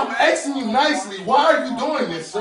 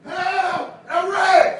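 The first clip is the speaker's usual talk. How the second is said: calling for help; 7 semitones higher, 4 dB louder, much slower than usual. Real, not acted. Calling for help during arrest.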